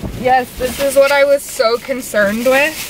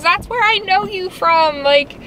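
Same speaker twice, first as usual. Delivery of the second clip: quoting the guy; 8 semitones higher than usual. Retold or imitated. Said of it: "He goes".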